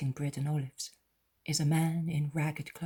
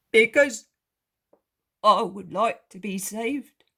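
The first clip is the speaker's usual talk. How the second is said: weeping beggar; 6 semitones higher, 10 dB louder than usual. Acted. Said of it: "he begins to weep slowly".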